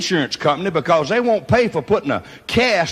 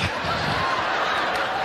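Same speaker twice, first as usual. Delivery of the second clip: breathy laugh; 6 dB quieter than usual. Real, not acted. Laughing at his own list.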